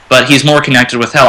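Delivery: ordinary delivery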